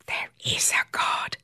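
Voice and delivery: gleeful whispered thought; exuberant whisper. A narrator's reading. Emma sees her old doctor on the waxing table, gleeful whisper. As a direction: whisper it with delight. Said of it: "she thought exuberantly".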